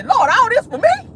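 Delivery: high, thrilled thanks to the Lord; mock grateful prayer. Voicing the lucky heir thanking the Lord, high and giddy. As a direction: play the stunned winner thanking God.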